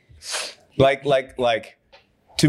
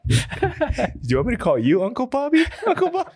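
high, fast, giggling tease; mock the name while laughing; teasing laughing question; much faster than usual. Friend teasing the host about his nickname, laughing high. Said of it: "that's funny".

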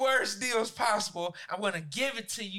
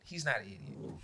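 recanting; 11 semitones lower, 8 dB quieter than usual. Real, not acted. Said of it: "Tried to recant that".

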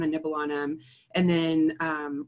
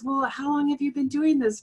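higher, lightly voiced patient asking gently; patient's question; 9 semitones higher than usual. Patient asking the vegan therapist how long, gently higher. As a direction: play the patient, curious and kind, pitched up. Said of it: "she goes".

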